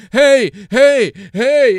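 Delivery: high, loud hey hey; mock yelling reporter. Reporter shoving the mic at her, loud high mock call. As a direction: bark for attention, mocking. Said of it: "the C N N guy is like".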